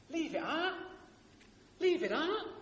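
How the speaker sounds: put-on high-pitched EastEnders London accent. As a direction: squawk it in soap-opera London. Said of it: "I'll do the accent".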